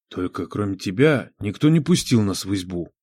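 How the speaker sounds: low, hushed reproach; reproachful whisper. A narrator's reading. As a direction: whisper it with quiet blame. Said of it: "Укоризненно прошептал Нечай".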